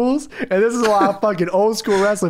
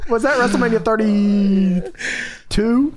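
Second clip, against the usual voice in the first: ordinary delivery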